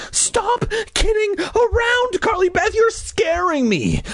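put-on high, slow protest from a scared boy; angry but afraid. Brother telling his sister to stop, angry fear. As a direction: whine it high, angry and frightened. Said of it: "His voice sounded angry, but his eyes revealed fear".

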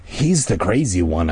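usual speaking voice

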